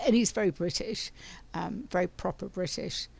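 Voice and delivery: normal talking voice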